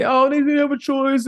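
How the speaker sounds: high, whiny-sounding mimicry of complainers; mock objection. Host mimicking people who excuse the employee, mock objection. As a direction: raise the pitch to mock the excuse. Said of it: "Some people are gonna say".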